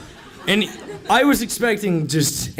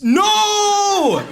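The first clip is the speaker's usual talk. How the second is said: high shouted No with a laugh; mock shout; 14 semitones higher than usual. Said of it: "I could have been like".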